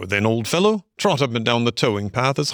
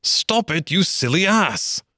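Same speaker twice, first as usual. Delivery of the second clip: alarmed cry; 8 semitones higher than usual. A narrator's reading. Rat to Mole from the boat's bottom, high alarmed cry. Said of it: "cried the rat from the bottom of the boat".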